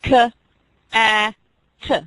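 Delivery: "K, A, T" said as separate sounds; segmenting sounds. Shown as a demonstration. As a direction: tap out each sound. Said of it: "How many sounds are in cat?".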